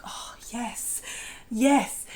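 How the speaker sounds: whispered swooning yes, then voiced; breathy delighted yes. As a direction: sigh it out like a dream come true. Delighted reaction to quiet men.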